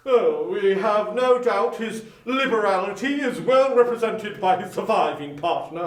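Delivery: lightly voiced charity gentleman; polite portly gentleman. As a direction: greet him with formal politeness. Gentleman continues.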